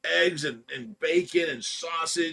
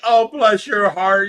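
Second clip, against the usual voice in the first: loud, slow, higher gushing grandma; 5 semitones higher, 10 dB louder, slower than usual. Retold or imitated. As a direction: coo it loudly like a grandma swooping in on a toddler. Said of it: "Grandma comes in, it's like".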